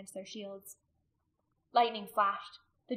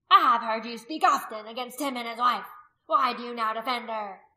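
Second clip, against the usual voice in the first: loud accusing challenge; crying out; 3 semitones higher, 11 dB louder than usual. A narrator's reading. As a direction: call him out before the crowd. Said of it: "another cried out".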